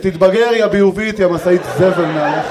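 loud scolding snap; angry wife. Retold or imitated. Wife scolding husband for farting, loud mock anger. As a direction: tell him off, disgusted. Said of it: "מסתכלת עליי כועסת".

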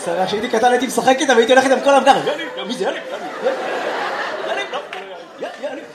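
peer like a rooster spotting a friend; mock rooster calling yalla. Imitating a rooster peering.

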